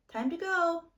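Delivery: high, loud call to leave. A narrator's reading. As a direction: call her sister from afar. Naya calling sister to leave.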